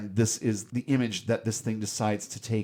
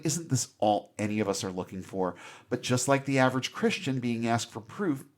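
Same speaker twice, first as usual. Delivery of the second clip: ordinary talk, nothing marked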